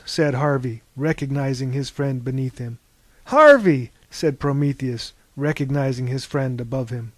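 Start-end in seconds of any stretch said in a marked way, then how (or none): 3.3-3.9 high, loud cry of the name; surprised recognition. A narrator's reading. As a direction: call out the name in glad surprise. Recognizing friend in grave.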